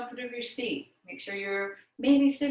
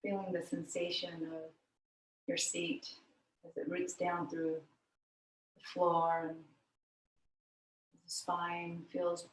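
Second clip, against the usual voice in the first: guided meditation; 5 semitones lower, 6 dB quieter than usual. Real, not acted. Yoga teacher guiding students into the breath, meditative. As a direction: lower and quieter, easing them gently into stillness.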